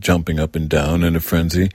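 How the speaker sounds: ordinary delivery, nothing marked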